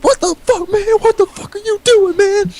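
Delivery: mock panicked kid, way up high; high squeaky outraged kid. Kid rubbed at lunch, squealing high in protest. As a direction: squeal it high like a freaked-out boy. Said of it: "and just goes, he's like".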